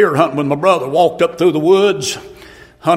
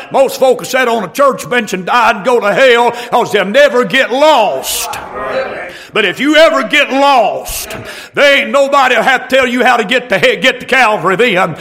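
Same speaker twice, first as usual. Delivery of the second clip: high, shouted sermon cry building to the end; fiery preaching climax; 6 semitones higher than usual. Real, not acted. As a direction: drive the altar call home at full voice. Closing altar-call climax.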